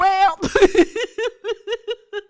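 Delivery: a loud, high burst of laughter. Real, not acted. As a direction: crack up out loud. Laughing at Emmanuel joke.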